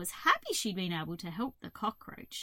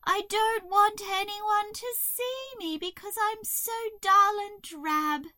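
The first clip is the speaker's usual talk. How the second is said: high, loud wailing crow voice; miserable crow; 9 semitones higher, 7 dB louder than usual. A narrator's reading. Crow not wanting to be seen, miserable. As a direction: wail it, slowing at the end.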